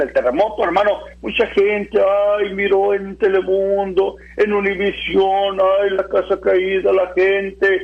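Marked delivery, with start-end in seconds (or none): 1.2-7.8 slow, drawn-out imitation of pitying onlookers; mock pity. Preacher mimics people watching earthquake news on Telemundo, mock pity. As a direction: stretch every word into a monotone mock lament. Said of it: "eso es lástima".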